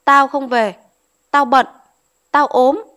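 flatly refuse, chopping each phrase; defiant refusal. A narrator's reading. Minh refusing to go home with the servant, defiant.

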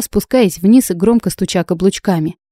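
ordinary delivery, nothing marked

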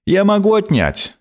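insolent smirk. A narrator's reading. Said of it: "нагло ухмыльнулся".

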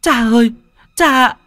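high, loud anguished cry for father; wailing cry. Acted. Daughter screaming for her stabbed father, high loud wail. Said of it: "thì gào lên".

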